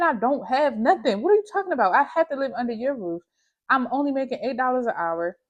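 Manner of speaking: exasperated rant. Retold or imitated. Exasperated inner rant at mom.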